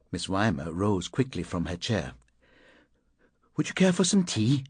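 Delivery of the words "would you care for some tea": lightly voiced woman, higher pitch; polite offer, pitched up; woman character. A narrator's reading. Woman offers the visitor tea, higher polite voice.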